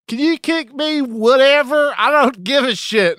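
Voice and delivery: high, loud, offhand customer; careless customer voice. Retold or imitated. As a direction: toss it off like a carefree diner. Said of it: "someone just being like".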